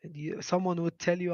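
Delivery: usual speaking voice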